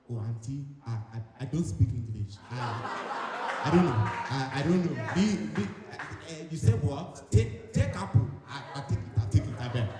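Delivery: hammed-up African accent, halting broken English; stammering, confused African-accented shopper. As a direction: play dumb in a thick African accent. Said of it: "so when I speak in an African accent".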